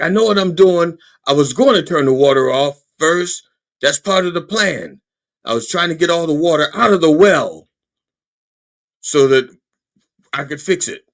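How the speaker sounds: loud, stubborn self-justifying; defensive insistence. Acted. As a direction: argue back at the wife, digging in. Husband defending his botched plumbing.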